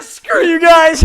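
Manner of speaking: high, drawn-out shout through laughter; laughing mock protest. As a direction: holler it while cracking up. Joking outburst while laughing hard.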